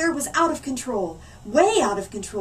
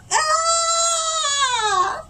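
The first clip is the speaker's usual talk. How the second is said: loud, very high scream; boy's scream; 4 dB louder than usual. Acted. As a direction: let out a horrified shriek. Oliver screams again.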